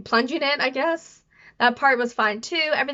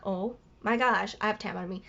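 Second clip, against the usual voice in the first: retold quiet amazement; 3 semitones lower, 7 dB quieter than usual. Girl to herself with tampon in, hushed amazement. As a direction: act out quietly realising it. Retelling own stunned reaction.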